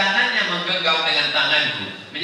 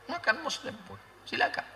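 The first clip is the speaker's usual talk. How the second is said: much softer, almost under the breath; soft aside; 8 semitones higher, 12 dB quieter than usual. Shown as a demonstration. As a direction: drop the voice to a hush. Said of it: "Dia kan ada dua tenda".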